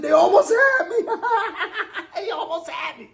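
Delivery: high, laughing through the words; cracking up at the joke; giggling it out. Real, not acted. Laughing repeat of the line.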